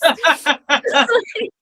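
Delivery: a loud real laugh, high; amused laugh. Real, not acted. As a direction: burst out laughing at the joke. Laughing at friends' joke.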